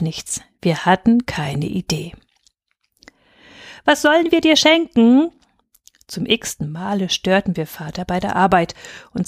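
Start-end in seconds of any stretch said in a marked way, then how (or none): 3.8-5.3 high, louder eager demand; nagging children. A narrator's reading. Children nagging father at work, high and eager. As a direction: pester him, excited and loud.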